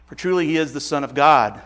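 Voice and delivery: usual speaking voice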